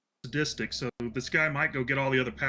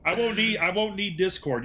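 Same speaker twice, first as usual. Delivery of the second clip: mock yell; 6 semitones higher, 3 dB louder than usual. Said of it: "You know what I'm yelling".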